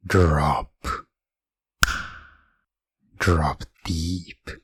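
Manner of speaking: trigger word given steady and commanding; firm trance trigger; hypnotic command. Acted. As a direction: send the listener down into trance.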